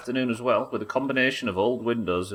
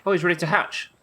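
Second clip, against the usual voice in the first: excited; 9 semitones higher, 6 dB louder than usual. Real, not acted. Excited pal ready to hatch.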